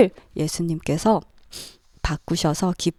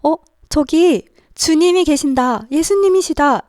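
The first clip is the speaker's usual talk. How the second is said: high, loud cry of recognition; excited calling out; 9 semitones higher, 6 dB louder than usual. Acted. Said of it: "요한이 외쳤어요".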